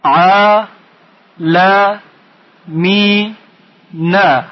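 "ع، ل، می، نه" as four isolated beats; syllable by syllable. Shown as a demonstration. Teacher splitting the word for pupils, syllable by syllable. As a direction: pause after each syllable, hold "می" long. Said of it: "تفکیک کنید".

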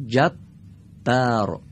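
جبار drawn out very slowly, the doubled ب held; slow demo word. Shown as a demonstration. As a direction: read جبار slowly for the class. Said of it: "دقت کنید".

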